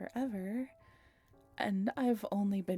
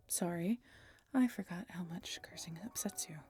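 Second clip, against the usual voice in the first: whisper; 2 semitones lower, 6 dB quieter than usual. Acted. Whispered apology roleplay.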